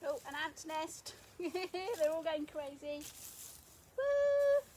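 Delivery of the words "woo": high, loud whoop of glee; excited whoop. Real, not acted. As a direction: let out a real whoop of surprise. Excited whoop at the ant nest.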